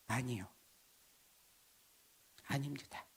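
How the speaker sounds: hushed confession. Retold or imitated.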